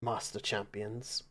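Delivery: a lightly voiced host bidding champions farewell; courteous host's farewell.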